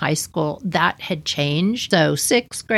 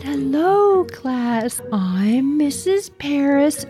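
lightly voiced frail teacher, higher and slow; 7 semitones higher, much slower than usual. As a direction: play a timid little teacher introducing herself, higher and slow. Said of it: "you could barely hear her".

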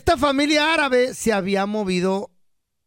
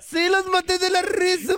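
high kid voice with mock Arabic accent; raised, put-on child voice; 7 semitones higher than usual. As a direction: insist to mom like a little boy. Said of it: "Y el niño dice".

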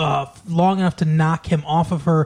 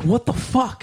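pitched up in mock disbelief; a pitched-up, incredulous what the fuck; 7 semitones higher than usual. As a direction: react like it's absurd. Mocking a bizarre movie scene.

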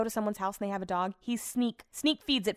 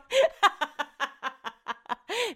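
high laugh; 10 semitones higher than usual. Real, not acted. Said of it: "I need to go to therapy".

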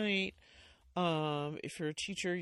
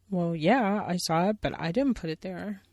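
louder, defensive bear voice; lightly voiced puppet bear; Billy Bear voice; 2 semitones higher, 10 dB louder than usual. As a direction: shrug it off like a cub caught out.

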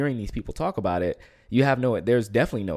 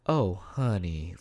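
pitying oh honey; 3 semitones lower, 4 dB quieter, much slower than usual. Retold or imitated. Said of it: "they will go".

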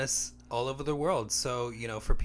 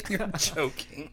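laughing; 8 semitones higher, 9 dB louder than usual. Real, not acted. Laughs at coughing co-host.

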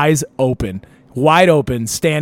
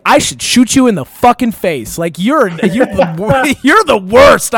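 mock fury; 6 semitones higher, 5 dB louder than usual. Furious at friend next morning.

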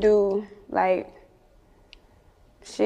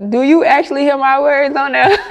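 louder and higher, laughing disbelief; amused challenge; 5 semitones higher, 6 dB louder than usual. Retold or imitated. Rapper answering excited callers, loud and amused. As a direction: push back with mock disbelief. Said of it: "And I'm like".

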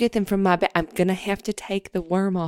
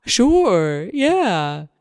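slow, louder silly worm; worm puppet voice; 5 dB louder, much slower than usual. Acted. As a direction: bob along as a cheerful worm. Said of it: "it's a hand puppet".

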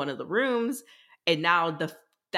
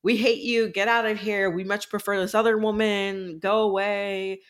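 crowd rejection; 5 semitones higher than usual. Retold or imitated. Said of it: "everyone's like".

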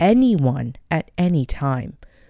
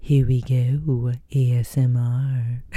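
slow, drawn-out playful ASMR voice; mock ASMR; 2 semitones lower, slower than usual. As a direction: pretend you're hosting an ASMR session, slowly. Playfully demonstrates ASMR, then laughs.

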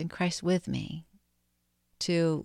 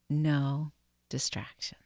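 slow whispered prayer; slow breath prayer. Real, not acted. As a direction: breathe each phrase slowly. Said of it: "let's just pray this breath prayer together now".

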